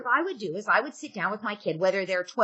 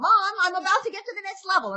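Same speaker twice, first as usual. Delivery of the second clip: put-on gamer kid; 8 semitones higher, faster than usual.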